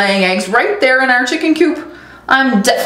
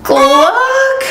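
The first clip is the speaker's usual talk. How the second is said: put-on high squawky gluck of a young rooster; failed rooster crow; 13 semitones higher than usual. Young rooster Rudy trying his first crow, squeaky.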